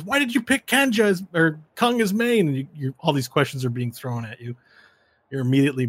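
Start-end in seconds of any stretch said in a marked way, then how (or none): 0.0-2.5 high, eager question; curious fan. Acted. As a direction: pester the champion. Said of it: "people are asking you questions".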